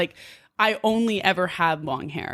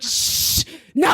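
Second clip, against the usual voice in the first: shushing; 9 semitones higher than usual. Retold or imitated. Cutting off her mom.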